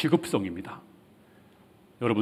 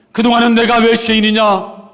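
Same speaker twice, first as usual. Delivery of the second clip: high, loud acted self-defence; indignant protest; 11 semitones higher, 8 dB louder than usual. Preacher acting the old Zacchaeus denying guilt, indignant protest. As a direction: shout the question in protest. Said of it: "이렇게 변명이 많았을 것입니다".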